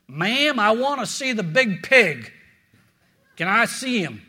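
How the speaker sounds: lightly voiced Texan cowboy asking for the pastor; Texan drawl. Preacher doing a tall Texan at the church office, light Texan drawl. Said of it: "he had this Texan drawl".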